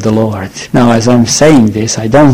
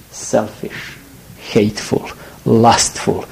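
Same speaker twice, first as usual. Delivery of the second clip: hushed list; 3 semitones lower, 8 dB quieter than usual. Acted. Preacher lists sins in a hush.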